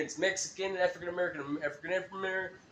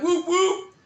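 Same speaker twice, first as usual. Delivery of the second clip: high, loud whoop call; juggalo call; 12 semitones higher, 6 dB louder than usual. Retold or imitated. Greeting a fellow fan, a high loud whoop.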